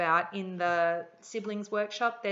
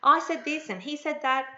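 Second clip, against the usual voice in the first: lightly mimicked child's complaint, higher and louder; higher-pitched mock tattling; imitating tattling kids; 7 semitones higher, 3 dB louder than usual. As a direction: reel off grievances like a kid telling tales. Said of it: "they tell me these big stories".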